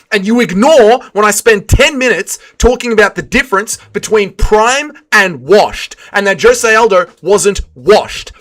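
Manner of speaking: exasperated rant, hammering key words; building from irritated protest to furious outburst; crashing out. Real, not acted. Streamer ranting at Shotgun for ignoring what he said, exasperated crash-out. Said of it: "I didn't mean to crash out so badly".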